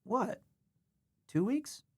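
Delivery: puzzled double-take at the correction; surprised question. Real, not acted. Streamer reacting to chat correcting three weeks, surprised.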